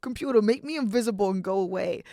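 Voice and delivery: lightly hammed-up disbelief; panicked denial. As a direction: brush it off in a half-joking panic. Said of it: "And I'm like".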